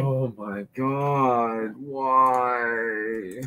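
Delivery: exasperated groan. Real, not acted. Groans at the repeated passage.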